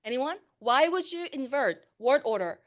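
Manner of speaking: ordinary delivery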